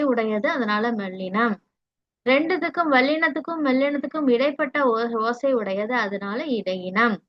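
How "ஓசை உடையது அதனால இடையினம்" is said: ordinary talk, nothing marked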